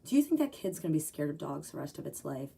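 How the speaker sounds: usual speaking voice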